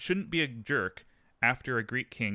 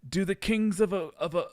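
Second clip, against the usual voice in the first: higher, louder put-on pharaoh voice; a grand, hammed-up pharaoh greeting; terrible pharaoh accent; 8 semitones higher, 5 dB louder than usual. Pharaoh Seti welcoming the Trojan prince, hammed-up higher voice. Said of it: "Seti, the pharaoh ... said".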